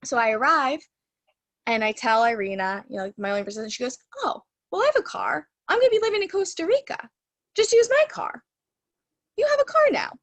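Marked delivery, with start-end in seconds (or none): 4.2-10.1 very high, breezy imitation of a friend; imitated breezy friend. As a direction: offer it lightly, pitch climbing higher and higher. Acting friend's breezy car offer.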